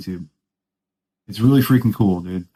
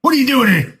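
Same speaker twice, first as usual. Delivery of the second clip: much higher mock challenge; imitating an angry guard, higher; retold angry guard; 12 semitones higher than usual. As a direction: bark it like a furious guard. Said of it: "He was pissed".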